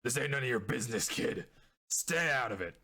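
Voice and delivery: a lightly voiced gruff thug, fast; lightly gruff street thug warning a kid off. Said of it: "in a very, uh, gruff voice".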